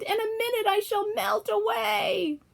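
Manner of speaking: high, slow shrieking witch; the witch screaming as she melts. A narrator's reading. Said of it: "she screamed".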